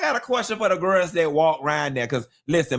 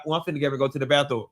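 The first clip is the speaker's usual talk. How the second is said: lower, rushed sly excuse; quick sly excuse; 6 semitones lower, much faster than usual. Retold or imitated. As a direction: rattle off a sneaky excuse fast. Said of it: "you be like".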